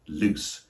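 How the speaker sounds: LOOSE ending in a crisp S; voiceless ending. Shown as a demonstration. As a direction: say loose as "looss", no buzz. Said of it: "with a S sound".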